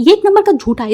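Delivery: high and loud accusation; angry accusation. Acted. Madam warns Wang not to believe the boy, loud angry. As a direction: call him a liar at full pitch. Madam exposing son's lie.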